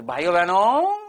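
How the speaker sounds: stretched oratorical call to the audience; Prime Minister impression. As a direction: imitate a politician hailing a crowd. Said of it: "हमारे प्रधानमंत्री".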